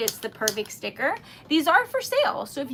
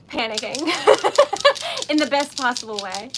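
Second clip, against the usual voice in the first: usual speaking voice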